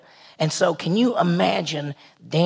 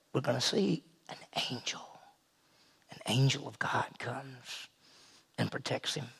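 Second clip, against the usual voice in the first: hushed awe; 6 semitones lower, 16 dB quieter than usual. Real, not acted. Preacher revealing the angel who protects Daniel, hushed. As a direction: drop to a very soft, low near-whisper.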